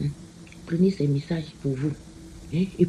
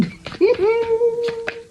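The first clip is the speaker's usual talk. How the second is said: high laugh. Real, not acted. Laughs at her card pick.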